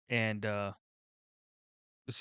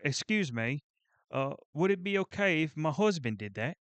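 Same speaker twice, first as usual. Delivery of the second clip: put-on higher-pitched, amateur Australian accent; 5 semitones higher, 4 dB louder than usual. Australian wife asks if her husband can shovel, attempted Australian accent. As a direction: imitate an Australian wife asking politely, accent not quite there. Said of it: "I don't know how to do Australian".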